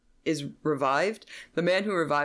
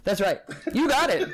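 laughing; 10 semitones higher than usual. Real, not acted. Laughing reply.

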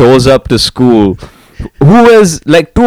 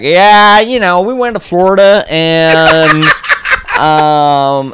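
higher, lazy put-on American accent; fake American accent; 9 semitones higher, slower than usual. Mocking cousin back from America, fake American accent. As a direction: sound like a kid faking an American drawl. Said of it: "an American accent".

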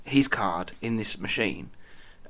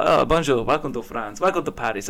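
lightly put-on French accent for the welcome; a hint of a French accent, friendly; understated French accent, man greeting a tourist; mock French accent. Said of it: "I don't know why I sound like this".

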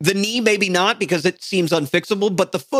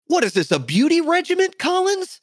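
mock outrage; 11 semitones higher than usual. Real, not acted.

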